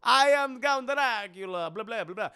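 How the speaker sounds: mock vampire introducing himself grandly; hammed-up Dracula. Dracula introduces himself, hammed-up vampire voice. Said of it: "Dracula just walks in and goes".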